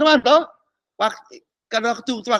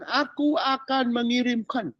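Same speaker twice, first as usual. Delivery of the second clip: voicing God; 4 semitones higher than usual. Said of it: "Tuhan bilang".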